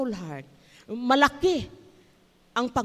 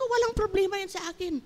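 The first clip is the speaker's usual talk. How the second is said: high cheerful reassurance; 12 semitones higher than usual. Retold or imitated. Retold reassurance to guest.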